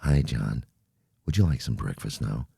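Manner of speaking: a steady, low HAL; Hal computer voice. Retold or imitated. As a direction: play the polite ship computer, low and even. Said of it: "he spoke like this".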